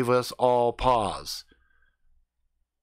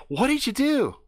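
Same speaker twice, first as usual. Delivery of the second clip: high, bright-eyed question in her put-on voice; girl voice asking what he did; 14 semitones higher than usual. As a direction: ask it the way she would.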